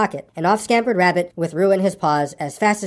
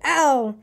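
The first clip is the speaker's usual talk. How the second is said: higher-pitched, falling "ow"; bumped "ow"; 7 semitones higher than usual. A narrator's reading. Piglet landing in Kanga's pocket, bumped ow. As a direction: let out an "ow" as if landing hard. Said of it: "as he came down, he said".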